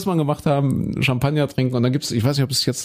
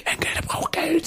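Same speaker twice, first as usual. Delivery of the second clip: high whispered gossip; high, hushed old-lady whisper; 14 semitones higher, 6 dB quieter than usual. Acted. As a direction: whisper it behind his back like a nosy granny. Skit, grandson wants money.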